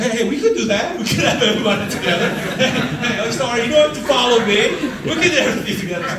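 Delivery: high, loud, rushed and laughing; mock eager agreement. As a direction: gush agreement while laughing at yourself. Pastor mocking his own eager agreement.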